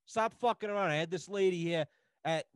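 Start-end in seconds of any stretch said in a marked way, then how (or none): none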